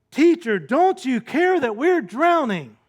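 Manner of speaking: high, loud panicked cry; frantic yell. Retold or imitated. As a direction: yell it like you're drowning. Frenzied disciples wake Jesus.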